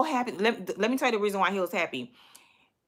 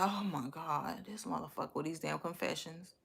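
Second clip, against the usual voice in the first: muttered to herself; 4 semitones lower, 14 dB quieter than usual. Retold or imitated. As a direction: grumble it quietly, fed up. Inner reaction to boyfriend's confession.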